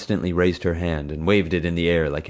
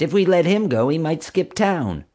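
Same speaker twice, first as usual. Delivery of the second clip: higher, plaintive whine; whining; 12 semitones higher, 5 dB louder than usual. Acted. Deflated officer whining to her boss. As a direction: plead like a thwarted child. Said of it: "she whined".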